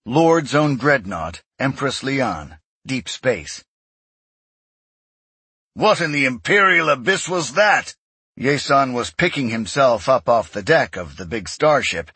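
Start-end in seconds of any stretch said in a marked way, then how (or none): 5.7-8.0 higher-pitched, startled demand; alarmed outburst after being thrown down; shocked. A narrator's reading. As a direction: demand an answer in fear and shock. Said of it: "eyes wide with honest, naked fear and shock".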